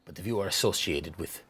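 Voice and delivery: low, quiet, controlled refusal; stern shopkeeper. Acted. Shopkeeper turning away unwelcome customers, low and stern. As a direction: dismiss them in a low, soft voice. Game master voices shopkeeper.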